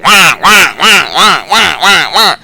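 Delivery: loud, high crow caws; imitated crow squawks. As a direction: squawk like a flock of startled crows. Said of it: "They just like".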